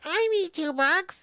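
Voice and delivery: high, slow mock demand; exaggerated high-pitched pleading; mocking employee imitation. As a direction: mimic her in a drawn-out high voice. Mimics employee demanding two bucks.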